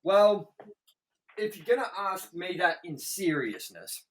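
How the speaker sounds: usual speaking voice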